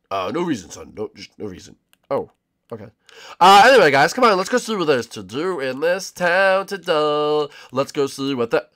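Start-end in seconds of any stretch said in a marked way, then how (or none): none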